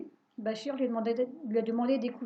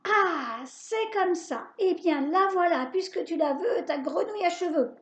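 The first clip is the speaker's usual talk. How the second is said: high, loud furious witch howl; 6 semitones higher, 6 dB louder than usual. A narrator's reading. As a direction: scream it like a defeated witch. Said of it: "la sorcière se met à hurler". (la sorcière hurle de rage, voix aiguë)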